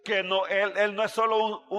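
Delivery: normal talking voice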